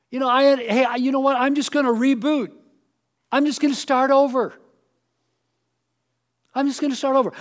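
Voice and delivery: cocky fool brushing off advice; mock eager fool. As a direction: play the guy who thinks he can just reboot. Voicing a fool's excuses.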